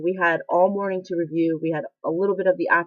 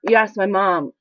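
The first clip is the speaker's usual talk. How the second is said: normal talking voice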